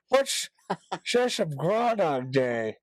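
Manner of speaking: high, slushy mock customer; imitating Alexa user. Shop customer quizzing Alexa about the holiday, mock slurred voice. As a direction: slur it slowly, rising into mock outrage. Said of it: "people in the shop asking".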